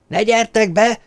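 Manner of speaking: high-pitched warning cry; frantic yell. A narrator's reading. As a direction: yell to keep people out of danger. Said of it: "üvöltötte". (magas hangon, kétségbeesetten üvöltve)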